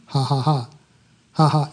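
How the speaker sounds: low, restrained mock chuckle; kept-down laugh. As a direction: laugh under your breath so the house stays asleep. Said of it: "he had to keep it down".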